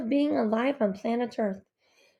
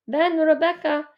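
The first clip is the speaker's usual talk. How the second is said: raised, higher calling voice; 5 semitones higher, 5 dB louder than usual. A narrator's reading. Father calls to mother to dance, calling out. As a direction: call across to someone, louder and higher. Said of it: "he calls to my mother".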